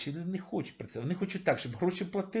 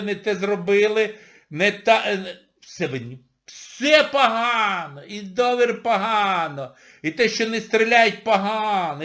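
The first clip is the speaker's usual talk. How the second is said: mock griping crowd; 3 semitones higher, 7 dB louder than usual. Imitating postwar complainers.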